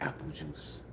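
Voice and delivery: apple juice whispered, juice as zhoos. Shown as a demonstration. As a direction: breathe apple juice under your breath. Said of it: "You're not trying to convince the audience".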